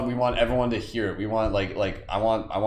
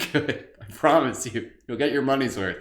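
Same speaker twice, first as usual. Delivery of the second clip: usual speaking voice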